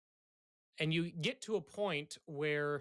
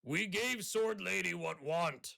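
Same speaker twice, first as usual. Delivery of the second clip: slow dim ogre voice; 5 semitones higher than usual. Acted. Ogre speaks broken English.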